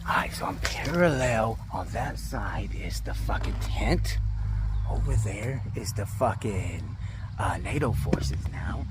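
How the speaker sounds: hushed stealthy whisper, slow. Real, not acted. Player hiding by the tent whispers to camera, whisper. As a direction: keep it breathless and hidden so nobody hears. Sneaking near enemy tent.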